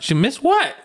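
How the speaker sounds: high-pitched disbelieving question; mock incredulous. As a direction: play at being floored by the news. Acted incredulous reaction.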